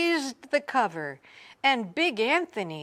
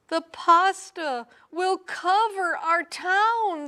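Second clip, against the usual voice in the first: cry; 10 semitones higher, 4 dB louder than usual. A narrator's reading. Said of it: "they cried".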